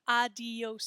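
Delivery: ADIOS as ah-dee-ohs; three-syllable adios. Shown as a demonstration. Teacher shows how the error sounds, adios in three syllables. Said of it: "say it with three syllables".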